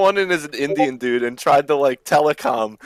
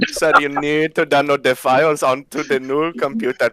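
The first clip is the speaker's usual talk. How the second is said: pleading scammer in a mock Indian accent; hammed-up Indian accent; Indian call center scammer. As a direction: put on an Indian tech-support accent. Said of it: "the Enclave Indian call center trying to scam".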